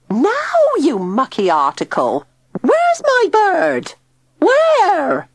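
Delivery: very high, loud furious screech; shrill demand. A narrator's reading. Tilly screeching at Digby about her lost bird. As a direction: scream it at him, outraged.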